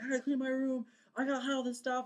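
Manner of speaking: high-pitched panicky self-quote; frantic, hammed-up scramble; freaking out. Dreamer rushing to tidy before a presidential visit, freaking out. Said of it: "freaking out".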